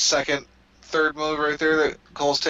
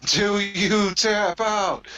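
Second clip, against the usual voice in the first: higher, very slow exaggerated mouthing; mock referee question; 6 semitones higher, much slower than usual. Referee asking the wrestler, slow mock question. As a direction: stretch each word out like a lip-read ref. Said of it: "know what he said there".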